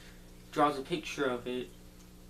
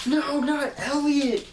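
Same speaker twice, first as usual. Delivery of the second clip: high, loud, drawn-out mock wail; hammed-up cry of desperate protest; anguished cry; 12 semitones higher, 9 dB louder, slower than usual. Kids as Elliot gets roped, anguished cry.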